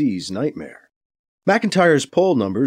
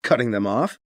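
usual speaking voice

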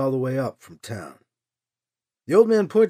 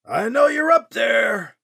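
higher, louder, slow warning shout; 6 semitones higher, 5 dB louder than usual. A narrator's reading. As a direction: call it out slowly, menacing. Man shouts at hidden Alibi.

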